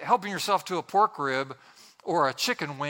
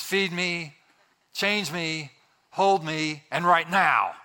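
mock infant demands, building louder; insist, ending on a loud demand; demanding baby; 3 dB louder, slower than usual. Preacher translating a baby's cry, mock demanding. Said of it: "wah," which is".

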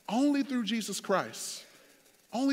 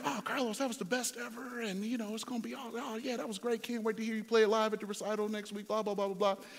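higher, softer mock-encouraging friends; gushing friends; 4 semitones higher, 4 dB quieter than usual. Friends praising his playing before the recital, imitated gushing. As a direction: imitate friends gushing, then tail into blah-blah.

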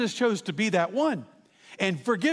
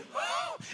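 high, sharp mock gasp; sudden breathy intake, acted; imitated gasp; 9 dB quieter than usual. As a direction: gasp as the dad in the story. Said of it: "shocks the world because he goes".

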